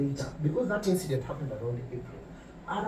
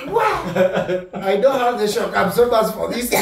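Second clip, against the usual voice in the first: louder, laughing through the words; laughing in disbelief; 3 semitones higher, 8 dB louder than usual. Retold or imitated. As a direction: laugh off the shock. Retold shocked reaction, laughing.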